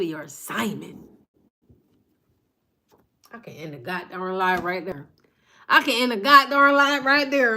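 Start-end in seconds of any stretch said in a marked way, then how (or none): 3.3-5.1 low, softer and held back; quiet, before the build; quiet first take. Real, not acted. Repeats the line for emphasis. 5.7-7.6 loud, fired-up repeat; loud emphatic repeat. Real, not acted.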